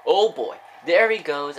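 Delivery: loud, high call of a breakaway; excited outburst. Real, not acted. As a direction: burst out as the runner takes off. Returner breaks loose on kick.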